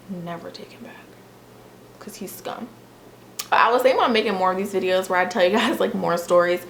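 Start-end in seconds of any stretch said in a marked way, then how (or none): 0.1-2.7 whisper. Real, not acted. Hushed warning to women viewers.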